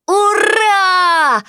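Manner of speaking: high, loud happy puppy cry; puppy cheer. Acted. Puppy cheers at good news.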